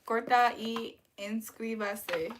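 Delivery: slow, steady corta y inscríbase; slow careful Spanish. Shown as a demonstration. Streamer repeating the Spanish phrase, slow and deliberate. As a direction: say corta y inscríbase slowly and evenly. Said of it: "I said, "Like and subscribe".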